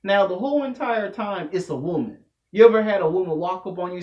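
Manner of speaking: ordinary talk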